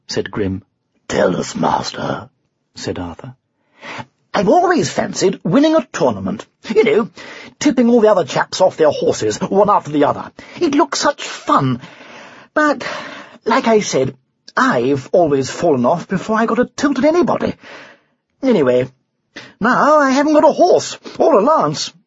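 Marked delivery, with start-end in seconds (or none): 1.1-2.3 low, deferential rumble; deep, rumbly lion. A narrator's reading. Lion asks his master to share his wish, deep and meek. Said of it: "deep, rumbly voice". 4.3-21.9 high, eager, then rueful knight; chatty knight. Acted. Knight confesses his tournament dream, eager then rueful.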